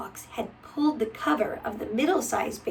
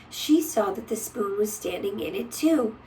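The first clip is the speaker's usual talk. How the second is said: plain reading voice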